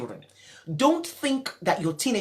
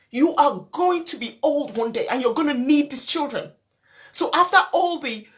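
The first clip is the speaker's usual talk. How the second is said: impassioned plea; 6 semitones higher, 3 dB louder than usual. Real, not acted. Impassioned appeal to parents.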